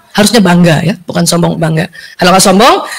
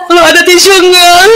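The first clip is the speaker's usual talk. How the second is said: higher, slow, tearful request; grieving friend; 6 semitones higher than usual. Retold or imitated. A grieving friend asking for a tissue, slow and higher. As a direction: ask for a tissue through tears. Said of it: "Kecuali dia minta".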